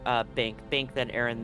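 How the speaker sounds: normal talking voice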